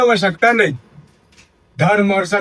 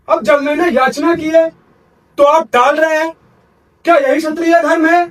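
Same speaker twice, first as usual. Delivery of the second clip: raised, reproachful challenge to the king; indignant rebuke; 6 semitones higher than usual. Acted. Sage rebuking the king for going back on his promise, indignant. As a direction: shame him for breaking his word.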